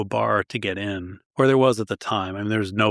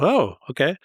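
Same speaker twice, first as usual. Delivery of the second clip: surprised; 6 semitones higher than usual. Retold or imitated. Retold reaction to listeners' demands.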